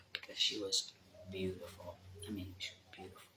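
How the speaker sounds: whispering about the secluded beach; whispered. Real, not acted. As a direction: whisper as if sharing a hidden spot.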